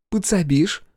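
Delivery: sly teasing female voice; Viv's voice. Acted. Viv asks for help.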